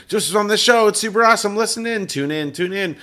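high, loud and hyped-up; excited promo voice. Retold or imitated. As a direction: pitch the show like a breathless fan. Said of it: "Carissa being like".

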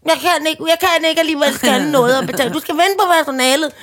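high imitated till voice; hammed-up machine giving up; malfunctioning checkout machine. Broken till sending customer to staff, mock machine voice. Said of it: "så gik den i sort og sagde".

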